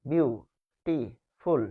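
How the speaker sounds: Beautiful broken slowly into বিউ, টি, ফুল; one breath pulse per syllable of beautiful; slow বিউ ... টি ... ফুল with clear gaps. Shown as a demonstration. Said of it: "অর্থাৎ এক শ্বাসে".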